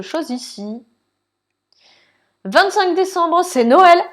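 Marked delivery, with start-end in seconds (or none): 2.4-4.0 higher and louder, delighted; excited. Acted. Player reading the Christmas date, thrilled. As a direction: sing out the date like a happy kid.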